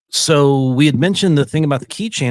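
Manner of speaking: ordinary delivery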